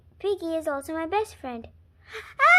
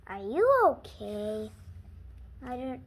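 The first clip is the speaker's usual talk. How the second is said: plain reading voice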